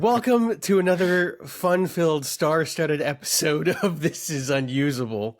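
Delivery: show-host welcome; announcer intro. Acted. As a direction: hype up the episode. Opening the podcast episode.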